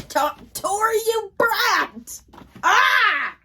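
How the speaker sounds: shrieking at Tori through the page; real outraged shout. Real, not acted. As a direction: yell at the book in real frustration. Real outburst at a book character.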